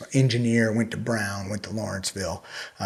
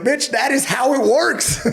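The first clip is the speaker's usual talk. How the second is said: much higher, louder, slow sassy comeback; mock retort; 14 semitones higher, 4 dB louder, slower than usual. Answering squeamish viewers with a slow sassy retort. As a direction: fire back with exaggerated sass. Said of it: "I'm like".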